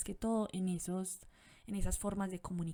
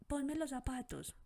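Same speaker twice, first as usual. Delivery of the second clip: put-on demanding child; 6 semitones higher than usual. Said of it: "ese niño".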